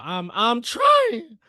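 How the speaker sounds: real laugh while struggling; laughing strain. Real, not acted.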